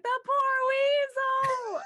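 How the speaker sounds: high, slow, loud laughing "poor weasel"; mock pity through laughter. Retelling her own reaction to friends, laughing. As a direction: drag it out, choking with laughter. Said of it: "And I was like".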